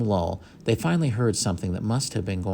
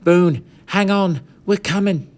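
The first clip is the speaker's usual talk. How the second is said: urgent call; 10 semitones higher, 6 dB louder than usual. Acted. Boys calling up urgently.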